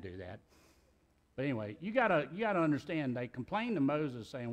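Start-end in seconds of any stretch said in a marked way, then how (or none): none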